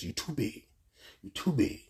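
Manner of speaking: echo in the head. Retold or imitated. Rejected man replaying her words in his head, as a soft echo. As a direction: replay the words softly, like a nagging memory. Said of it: "all I kept hearing in my head".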